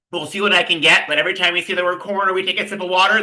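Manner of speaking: ordinary talk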